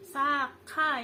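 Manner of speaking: "saka" split as sak-ka, heavy k; thickened ka. Shown as a demonstration. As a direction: hold back before the ka in "saka", then land it. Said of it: "ka-nya itu ditebelin".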